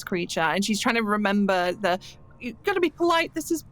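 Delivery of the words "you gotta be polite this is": high, hurried self-scolding; nervously talking herself into composure; a flustered scholar scolding herself; flustered inner voice. Acted. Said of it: "she has that flustered moment".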